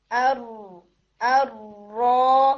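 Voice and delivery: ارو stretched very slowly with a held r; drawn-out r. Shown as a demonstration. Teacher slowly models ارو for students. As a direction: draw the r in ارو out long. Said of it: "اسی طرح سے ارو".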